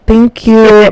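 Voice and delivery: high, retold awkward thanks; mock-polite thanks. Replying to the group staring at the hair, mock-polite.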